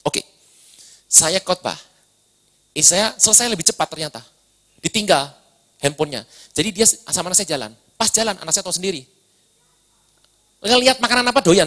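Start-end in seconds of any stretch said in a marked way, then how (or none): none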